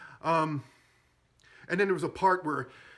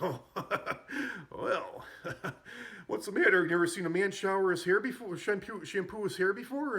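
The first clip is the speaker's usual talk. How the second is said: embarrassed laugh; 6 semitones higher than usual. Retold or imitated. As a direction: laugh it off while caught naked. Naked captain caught, embarrassed.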